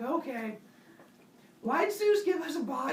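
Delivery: high, puzzled wife's voice. Acted. Pandora voiced questioning.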